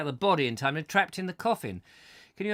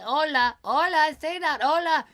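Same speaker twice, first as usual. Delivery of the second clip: high, loud fake Spanish coaxing; hammed-up Spanish crowd chant; mock Spanish urging; 12 semitones higher, 4 dB louder than usual. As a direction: egg the mime on in a silly high Spanish accent. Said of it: "there they would be going".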